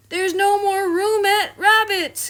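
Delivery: loud, high squashed cry; cried out. Acted. Douglas squeezed in, loud high cry. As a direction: cry it out from the crush. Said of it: "cried Douglas".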